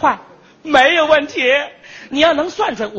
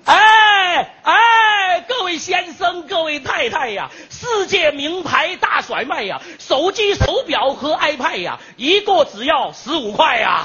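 street hawker calling; 2 semitones higher, slower than usual. Retold or imitated. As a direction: shout your wares to the street. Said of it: "怎么吆喝呢？".

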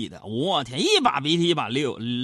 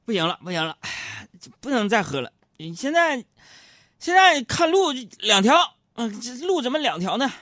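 slurring tipsy uncle rising higher; a light tipsy drinker, pitched higher; drunk uncle; 8 semitones higher, 3 dB louder than usual. Retold or imitated. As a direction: play the drunk seeing double. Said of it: "一个喝多了就说".

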